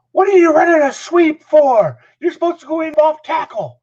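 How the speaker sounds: high, loud outraged shout; Dick Adams. Retold or imitated. Coach berating his running back, angry high shouting. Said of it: "He was, like, screaming at him".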